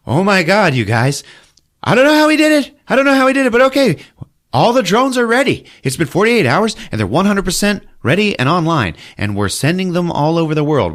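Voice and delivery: very high, loud, frantic excitement; excited Charlie Day. Retold or imitated. Charlie Day bragging about the drones, very high and loud. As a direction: gush over the drones at the top of your voice.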